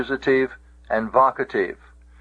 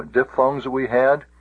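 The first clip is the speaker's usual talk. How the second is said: ordinary delivery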